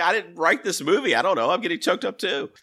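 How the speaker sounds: put-on high-pitched whiny teen girl voice; mock teenage daughter protesting; imitating daughter.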